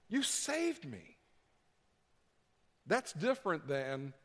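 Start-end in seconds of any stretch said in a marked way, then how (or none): 0.1-1.2 softer, higher, very slow and awed; tender hush. Retold or imitated. Believer answers Jesus in a soft, slow, awed hush. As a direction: hush it like a confession.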